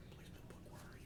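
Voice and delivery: hushed whisper to a book. Pretend. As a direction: whisper it secretly.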